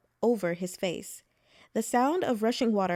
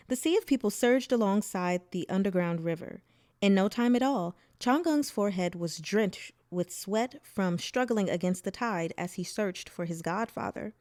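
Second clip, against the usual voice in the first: plain reading voice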